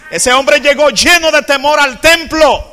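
loud, slow, driving sermon delivery; loud climactic preaching; full-force preaching. Acted. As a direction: preach it out to the whole church.

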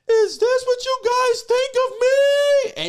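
high, loud, hurt cry of an offended woman; hurt outburst. Retold or imitated. The singer hearing the raunchy record, shrieking in hurt shock. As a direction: shriek it out like she's been insulted. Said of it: "jumps up and goes".

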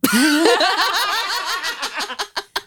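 high cackling laugh that trails on; long high laugh. Real, not acted. Co-host cracking up after teasing, high laughter. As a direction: burst out laughing and let it run.

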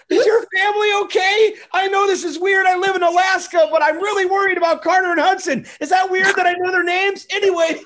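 high-pitched shouting in mock panic; frantic fan. Acts out worried fans' messages.

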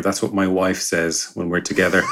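usual speaking voice

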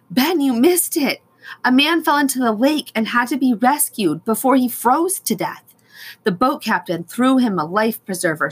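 high, loud, breathless rush of news; blurting it out all at once; excited. Acted. Sister rushes over with news.

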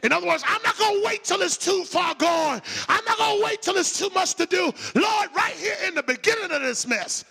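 loud preacher working up to a shout; fired-up, defiant sermon delivery; full-force preaching. Acted.

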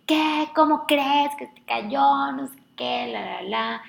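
high, loud, slowed disbelief; imitating family freaking out; mock hysterical. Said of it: "todo el mundo así de que histérico". (imitando a la familia histérica, voz aguda y alarmada)

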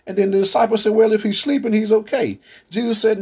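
high, rising sermon retelling; preaching at full force. Acted. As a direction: carry the story up in pitch with fervor. Retelling Lazarus story, raised pitch.

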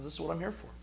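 softly, calm and gentle; quiet, tender reassurance; gentle reply. Retold or imitated. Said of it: "He just very gently responds".

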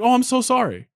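mimicked apology. Retelling waiter's apology.

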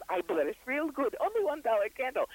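lightly done bad Russian accent, rising on the candle. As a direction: ham up a put-on Russian fortune teller accent. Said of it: "you do the bad Russian accent".

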